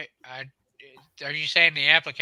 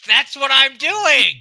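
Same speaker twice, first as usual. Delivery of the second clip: exasperated shout; 8 semitones higher, 9 dB louder, slower than usual. Real, not acted. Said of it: "It ain't working".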